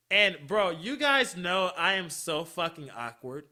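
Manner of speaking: ordinary talk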